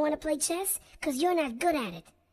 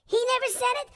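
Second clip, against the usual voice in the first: pitched up, stress on never; indignant disbelief; 7 semitones higher, faster than usual. Acted. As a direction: repeat it, now outraged at "never". Disbelief at denial.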